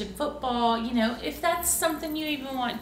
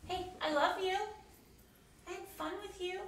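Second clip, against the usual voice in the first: soft; 2 semitones higher, 14 dB quieter than usual. Real, not acted. Bedtime goodbye, soft.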